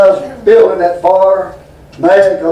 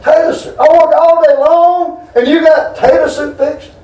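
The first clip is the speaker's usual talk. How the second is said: higher, indignant mock yell at the wife; husband ranting about tater soup, higher; retold angry outburst; 8 semitones higher than usual. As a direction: relive losing it over supper. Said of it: "I lost it".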